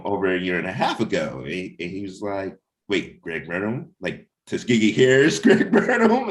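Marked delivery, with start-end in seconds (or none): 2.9-6.3 high, loud, incredulous retold reaction; amazed disbelief. Comic creator retelling a guest's disbelief, high and loud. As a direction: act out his stunned double take, voice shooting up.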